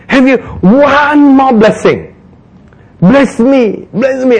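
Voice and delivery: high begging cry; pleading. Acted. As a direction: plead like a desperate son.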